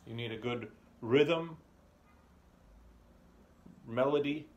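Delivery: ordinary talk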